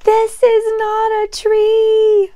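high loud panicked plea; alarmed. Acted. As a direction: cry out for help in alarm. Tiny startled on a dinosaur.

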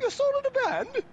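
very high, loud drawn-out disbelief; mock astonishment. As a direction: squeal it slowly, imitating an amazed friend. Quotes Fish's astonished reaction.

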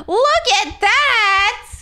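high, drawn-out exclamation; excited showing off. Real, not acted. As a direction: stretch it out high in playful amazement. Showing off her big hair.

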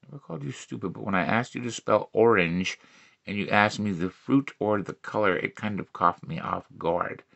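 low deadpan re-read. Acted. Comic repeats the joke about spelling orange, low and quiet. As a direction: drop it to a low, soft repeat. Repeats the same line, lowered.

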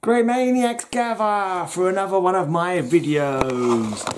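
high, slow, drawn-out host's call; hammed-up summons to the fans; theatrical show greeting. Host calling fans to gather for another video, theatrical greeting.

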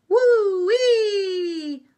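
long, loud, high siren imitation; siren wail. A narrator's reading. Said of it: "went a siren".

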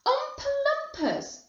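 very high, astonished echo; incredulous crowd cry; everyone exclaiming. A narrator's reading. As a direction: repeat it in high-pitched amazement. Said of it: "everyone said at once".

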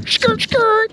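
playful high-pitched skid noise; a quick kid-like skid sound effect; mock tire skid. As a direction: goof around like a scooter peeling out. Pretending to ride old scooter.

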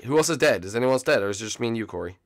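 ordinary delivery

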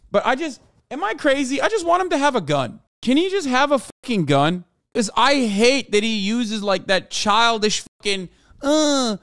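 high-pitched frustrated ranting; exasperated. Real, not acted. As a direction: plead in real exasperation. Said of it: "it's deeply frustrating for me".

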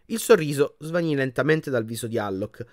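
ordinary delivery, nothing marked

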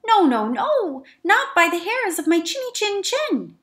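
high, loud squeaky refusal; a tiny goat shouting no from inside; high little goat defying the wolf. Acted.

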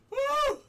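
loud high-pitched "woo"; real post-scare whoop; high relieved whoop. Real, not acted. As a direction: let the fright out in a high whoop. Shaking off the jump scare.

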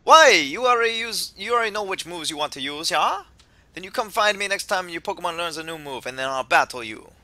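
put-on easygoing would-be Jamaican accent; attempted Jamaican accent. Kukui welcomes the player to Alola, attempted Jamaican accent. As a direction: do an amateur Jamaican voice, hammed-up. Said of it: "I know I can't do Jamaican".

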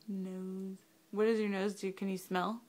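ordinary delivery

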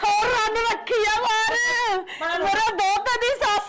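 slow, drawn-out public wail; wailing lament. Acted. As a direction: cry it out to the whole village. Wronged wife wails to the crowd. (ਲੋਕਾਂ ਅੱਗੇ ਵੈਣ ਪਾਉਂਦੀ ਆਵਾਜ਼)

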